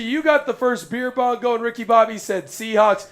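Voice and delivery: ordinary delivery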